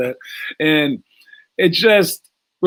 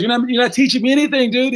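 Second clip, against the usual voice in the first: higher, exasperated mock complaint; frustrated kid; 8 semitones higher than usual. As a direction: whine it at the teacher, fed up. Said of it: "But he goes".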